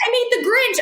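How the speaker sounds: put-on a child showing off her craft; little girl.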